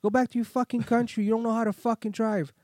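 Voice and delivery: high, loud acted tirade; insulting angry driver. Driver insulting him after apology, acted angry shouting. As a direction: hurl insults as the furious driver. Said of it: "kept insulting me".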